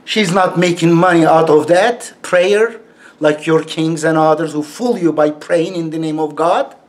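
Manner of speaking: usual speaking voice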